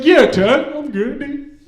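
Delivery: put-on high, strained panicky teen; mock panic. Cousin craving his vape answers Ted, mock panicky squeal. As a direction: imitate a jittery kid insisting too hard. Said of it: "starts to have a panic attack".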